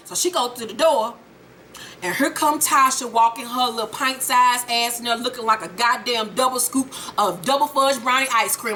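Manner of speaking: usual speaking voice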